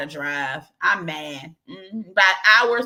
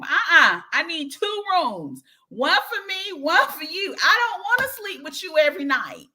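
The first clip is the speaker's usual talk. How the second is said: sassy refusal; 9 semitones higher than usual. Real, not acted. Insisting on separate rooms.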